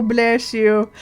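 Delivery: usual speaking voice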